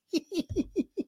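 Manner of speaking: high, breathless real laugh; burst of laughter. Real, not acted. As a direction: crack up at the joke. Reacting to a cockfight joke.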